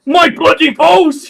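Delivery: very high, very loud pained yell. Acted. Cursed thug screams in pain, very high and loud. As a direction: scream it as the spell hits. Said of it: "You can hear this guy yell".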